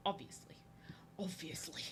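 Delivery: breathy unvoiced whisper; whispered aside. Real, not acted. Streamer adding a joke to chat, whispered. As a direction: murmur it like a secret.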